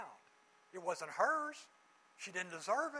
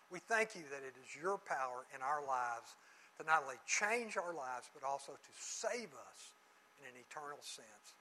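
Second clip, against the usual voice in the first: lower, hushed prayer voice; closing prayer; 6 semitones lower than usual. Acted. Closes in prayer.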